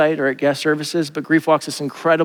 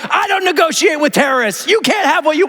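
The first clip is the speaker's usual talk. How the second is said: much higher, hammed-up action hero; tough action hero; 13 semitones higher than usual. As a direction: play Jack Bauer. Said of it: "I want Jesus to be like".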